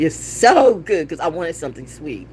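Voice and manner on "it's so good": slow drawn-out louder so good; savoring. Real, not acted. Raving to viewers about carrot custard. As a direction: draw out so good with delight.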